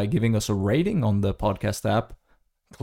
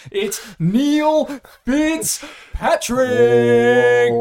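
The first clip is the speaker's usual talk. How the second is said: big slow hype intro; high, loud guest announcement; announcer voice; 12 semitones higher, 6 dB louder, much slower than usual. Real, not acted. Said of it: "announcing the guest".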